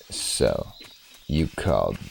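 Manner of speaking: very low raspy Snake growl. Shown as a demonstration. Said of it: "I can do a solid Snake voice".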